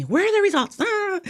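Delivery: high-pitched mock yell; high retold yell ending in a wordless cry; imitated sister yelling. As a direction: act out a pushy older sister yelling for an answer. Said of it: "was yelling at me".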